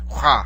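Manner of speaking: "Rah" with a guttural r from the back of the throat; throaty r. Shown as a demonstration. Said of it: "saying the R from the back of your throat".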